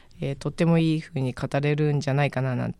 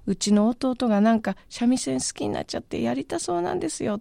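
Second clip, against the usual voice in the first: higher, lightly voiced older sister putting in a word; sister's voice; 8 semitones higher than usual. An older sister asks the master to take her brother on, in a lightly raised voice. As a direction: speak up for your little brother, a bit higher. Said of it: "と口添えしてくれて".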